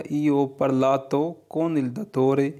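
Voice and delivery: very slow, each Italian word laid out clearly; slow model reading. Shown as a demonstration. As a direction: read it slowly for learners to copy. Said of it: "teacher models Italian sentence". (धीरे-धीरे साफ़ उच्चारण)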